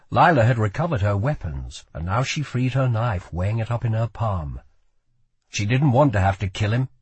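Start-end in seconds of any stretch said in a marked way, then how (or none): none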